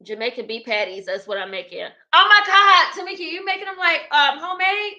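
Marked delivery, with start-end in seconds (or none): 2.1-4.9 high, loud burst of surprise; surprised. Real, not acted. Thrilled streamer asking her friend about homemade patties, surprised. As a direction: gasp at the news of homemade patties.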